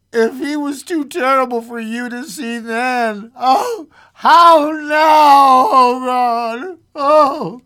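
high, loud crying lament; anguished wail. A narrator's reading. Husband recalling his mangled son to his wife, anguished. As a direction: build to a wailing shout.

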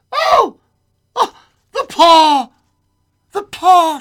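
very high, loud frantic cries; wild cry. A narrator's reading. Wife waking her husband with a sudden wild cry. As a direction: cry out wildly in bursts. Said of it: "a sudden wild cry from his wife".

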